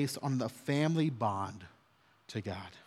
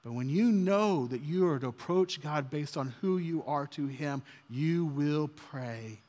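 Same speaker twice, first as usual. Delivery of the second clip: ordinary talk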